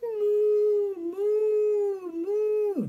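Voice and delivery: long, high, level moos; sustained high-pitched mooing; cow moo. Retold or imitated. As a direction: imitate a cow, held high. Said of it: "what does the cow say".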